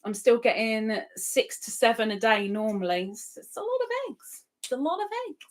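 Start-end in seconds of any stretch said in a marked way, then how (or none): 3.1-5.4 squeaky mock whine, much higher and softer. Streamer mocks a viewer moaning about too many eggs, squeaky. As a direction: pitch way up into a silly little voice. Mocking a viewer's egg complaint.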